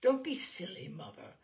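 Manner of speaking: husband snapping at his wife; irritable. Acted. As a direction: brush off the silly question. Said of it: "her husband replied irritably".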